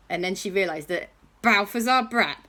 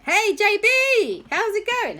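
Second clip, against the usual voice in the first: cheery greeting; 13 semitones higher, 4 dB louder than usual. Acted. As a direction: sing out the greeting to a friend. Kids greet J B on iPads.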